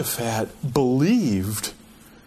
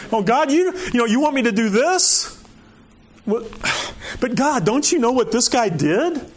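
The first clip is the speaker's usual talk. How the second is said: mock pleading prayer; 7 semitones higher than usual. Preacher voices his own pleading prayer.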